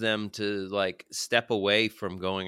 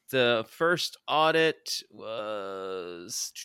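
wrestling announcer; much slower than usual. Pretend. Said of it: "pro wrestler announcement voice on".

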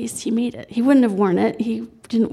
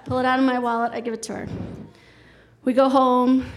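normal talking voice

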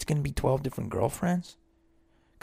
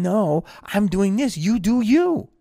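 higher and louder, slow, sure of yourself; confident self-assertion; 7 semitones higher, 6 dB louder, slower than usual. Retold or imitated. Confident person answering the haters, raised and slow. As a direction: plant your feet and tell them you do you. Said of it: "They're just saying".

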